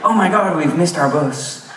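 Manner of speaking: high startled exclamation, slowing at the end; mock exclamation. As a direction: cry out as if suddenly realizing. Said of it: "and he exclaimed".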